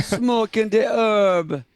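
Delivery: high, slow Jamaican voice; Jamaican accent. Retold or imitated. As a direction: stretch it out like a Jamaican beach seller. Echoes the Jamaican voice.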